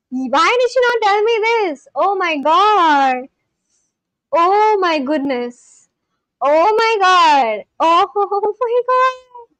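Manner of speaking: high, slow, stretched-out exclamations; overwhelmed delight. Real, not acted. Streamer seeing the dessert nobody told them about, overwhelmed delight. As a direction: wail it out in delight, breaking into a laugh.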